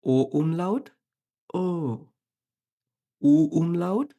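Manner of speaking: normal talking voice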